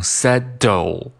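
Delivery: "settle" with a firm unpuffed t, like set-doe; unflapped stop. Shown as a demonstration. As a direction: plant the middle consonant of "settle" firmly. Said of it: "也不把它读成settle". (不送气、不跳舌读法)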